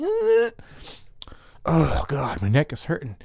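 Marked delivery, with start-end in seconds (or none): 0.0-0.5 sudden falsetto squeak; high squeak. Pretend.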